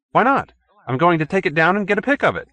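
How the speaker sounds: breezy brush-off, rattled out fast; brash reply. A narrator's reading. Perkins brushing off host.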